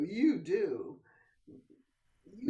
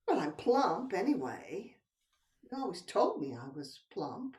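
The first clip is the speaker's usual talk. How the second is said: ordinary delivery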